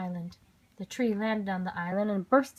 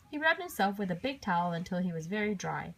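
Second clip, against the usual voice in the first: ordinary delivery, nothing marked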